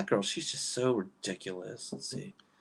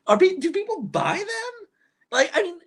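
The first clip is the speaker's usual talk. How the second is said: incredulous; 5 dB louder than usual. Real, not acted. Incredulous about keychain buyers.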